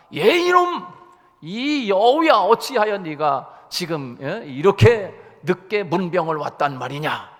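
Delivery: thundering lion's rebuke; angry roar. Acted. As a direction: bellow down at the sly fox. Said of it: "막 호통을 치는 거예요".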